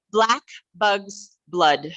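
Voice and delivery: slow, separate words with gaps; slow tongue twister. Shown as a demonstration. Leader introduces another exercise, slow deliberate tongue twister.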